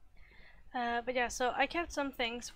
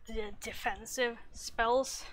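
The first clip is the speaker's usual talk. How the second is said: ordinary delivery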